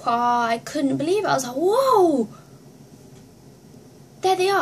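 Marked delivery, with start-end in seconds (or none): 1.6-4.6 high, loud, drawn-out whoa; amazed whoa. Retold or imitated. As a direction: let out a big awestruck whoa. Amazed at band coming on.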